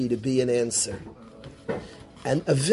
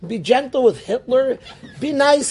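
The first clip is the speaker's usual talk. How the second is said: higher, louder mock disbelief; incredulous mock; 8 semitones higher, 3 dB louder than usual. Rabbi to students mocking the idea, incredulous. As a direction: say it like it's absurd.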